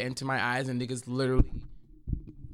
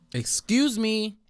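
polite excuse me; 6 semitones higher, 4 dB louder, much slower than usual. Retold or imitated. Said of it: "I was just like".

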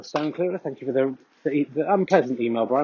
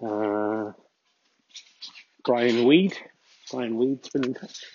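usual speaking voice

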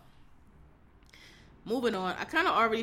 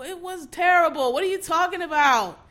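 indignant rant; 7 semitones higher, 7 dB louder than usual. Real, not acted. Ranting against hype.

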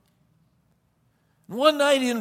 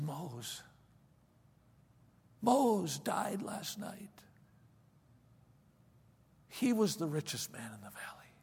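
hushed whisper; 11 dB quieter than usual. Acted. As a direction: whisper it like solemn news at dawn. Servant breaks news of death.

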